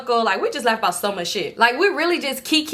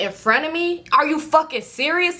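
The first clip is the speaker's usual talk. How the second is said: cussing out; 6 semitones higher than usual. Retold or imitated. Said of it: "So I'm cussing him out".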